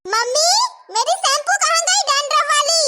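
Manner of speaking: annoyed shout for help finding something; calling out. Acted. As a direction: yell a question through the house. Daughter calls out for missing shampoo.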